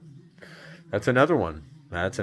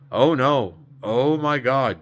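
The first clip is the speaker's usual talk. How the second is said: louder, higher reenacted shock; retold dismay; 6 semitones higher, 7 dB louder, slower than usual. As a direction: relive the shock, pitched up and loud. Retelling shock at what she saw.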